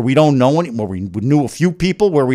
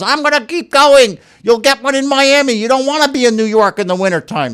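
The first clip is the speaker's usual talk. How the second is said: much higher, louder put-on voice of a tireless helper; Chaya's voice; 10 semitones higher, 5 dB louder than usual. Husband imitates the kidney matchmaker refusing to stop searching, high and loud. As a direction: pitch way up and push on as the woman who won't stop. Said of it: "It's exactly how she talks".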